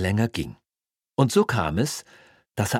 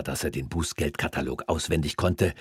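ordinary delivery

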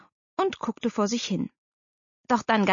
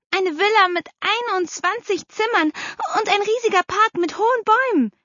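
put-on high, louder, marvelling child; excited daughter; 13 semitones higher, 5 dB louder than usual. A narrator's reading. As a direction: marvel out loud like a little girl. (schwärmendes Mädchen, hohe Stimme)

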